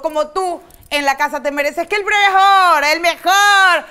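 high, excited proclamation; over-the-top hype. Acted. Host hypes today's programme as the world's best, over-the-top hype.

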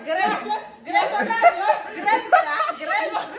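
high, giggling mock correction; laughing teasing. Real, not acted. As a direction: tease him while laughing. Teasing over height.

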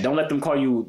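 ordinary talk, nothing marked

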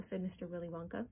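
plain reading voice

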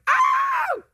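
sudden high loud yelp; startled shout. Retold or imitated. Preacher acting a jolt of power, a high shout. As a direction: yelp it out as the hairs stand up. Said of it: "You're like".

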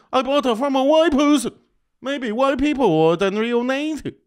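high, loud mock Chinese accent; hammed-up Chinese accent, high and shouted. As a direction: squeal it out in an exaggerated Chinese immigrant accent. Impersonating Chinese immigrant.

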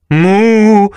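loud, high cow moo. Acted. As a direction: moo like a frightened cow-serpent. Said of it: "muggì Bessie".

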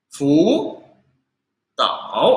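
"fu" rising, pause, "tao" dipping; changed tone. Shown as a demonstration. Teacher repeating the quick-reading example, rising then dipping. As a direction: give "fu" tone two and "tao" tone three. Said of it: "kayak gitu".

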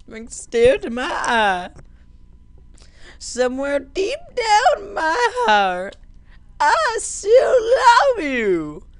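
high-pitched hammed-up sobbing wail; wailing in pretend heartbreak; mock crying. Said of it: "Best cry ever".

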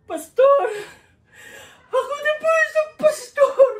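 high wailing cry of a weeping pastor; high, loud comic wail through sobbing laughter. Acted. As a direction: break into a loud comic sob. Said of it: "humagulgol din ang iyak si Pablo".

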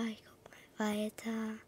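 fading breathy whisper; barely voiced, fading out. Real, not acted. Whispered softer repeat. (leiser werdendes Flüstern)

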